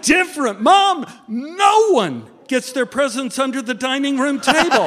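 put-on incredulous childish objection; indignant child's protest. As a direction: protest like a baffled five-year-old.

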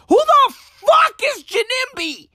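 shrieking who-is-this outburst; exasperated shout. Acted. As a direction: scream the question at the world. Repeated rant question, exasperated.

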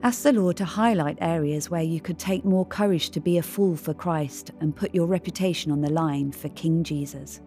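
ordinary talk, nothing marked

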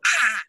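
high, loud "ah" burst; re-enacted yelp of joy; excited yelp. Designer hearing Megan say absolutely, excited yelp.

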